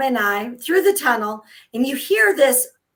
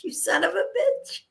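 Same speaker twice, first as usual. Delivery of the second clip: mock outrage; 6 semitones higher, 5 dB quieter than usual. Angry at reckless driver.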